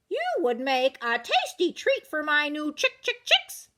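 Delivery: high clucky hen talk. Acted. Red hen clucking about a snack for her chicks.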